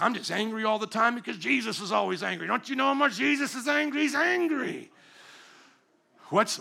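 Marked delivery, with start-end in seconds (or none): 0.0-4.9 mock angry believer. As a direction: parody someone convinced Jesus is always angry. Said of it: "everybody's like".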